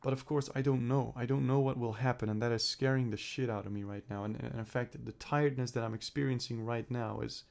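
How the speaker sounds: usual speaking voice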